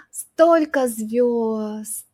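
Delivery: slow, awed Столько звёзд after a gasp; awestruck. Shown as a demonstration. Gazing at the night sky, awed and slow. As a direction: marvel at the night sky, slowly. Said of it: "you can say, gasps".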